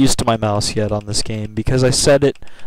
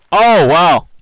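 loud, raised exclamation; exasperated surprise; 5 semitones higher, 7 dB louder than usual. Real, not acted. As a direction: blurt it out in disbelief. Reacts to shot in game.

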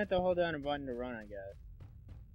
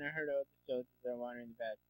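ordinary talk, nothing marked

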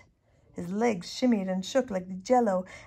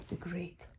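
ironic fading whisper; 3 semitones lower, 13 dB quieter than usual. A narrator's reading. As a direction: whisper it wryly. Ironic hush.

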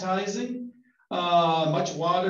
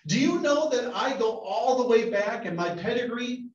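indignant Pharisees; 6 semitones higher than usual. Acted.

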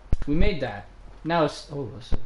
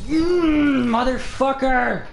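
loud, high, drawn-out swearing; growing groan into a slow, loud curse; frustrated curse; 10 semitones higher, 6 dB louder, much slower than usual. Real, not acted. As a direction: curse the game at full frustration. Cursing at a failed jump.

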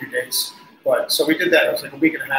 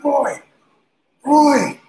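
high, raised call of a name; 11 semitones higher, 6 dB louder than usual. Retold or imitated. On stage, calling the deaf drummer again: high raised call. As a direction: shout to be heard through the in-ears. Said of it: "It's loud on there".

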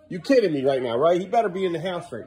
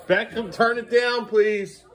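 slow, loud, higher call upstairs; 8 semitones higher, 4 dB louder, slower than usual. Real, not acted. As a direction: drag it out so he hears you. Calling to kid upstairs.

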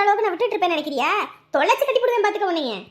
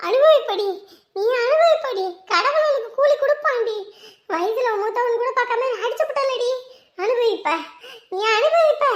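vengeful cursing; 5 semitones higher than usual. Acted. Slapped elder hurling parting curses at the younger woman, outraged. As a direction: fling each curse after her, pitch leaping up.

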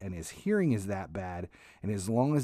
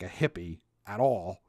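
ordinary delivery

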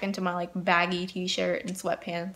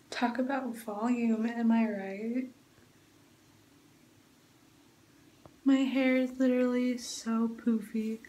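much softer, slow late-night murmur; quiet bedtime voice; 8 dB quieter, slower than usual. Real, not acted. Vlogger checks in hours later before going to sleep, hushed. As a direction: keep it hushed and unhurried, winding down for bed.

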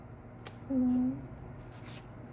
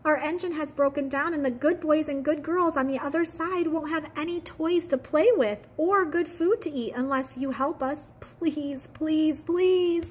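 higher, louder begging toy voices; stranded toys begging for help, higher and louder; toys pleading; 6 semitones higher, 3 dB louder than usual. Acted. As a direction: plead with the engine, rising to the last please. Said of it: "cried the dolls and toys".

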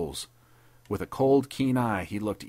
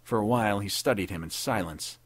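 ordinary delivery

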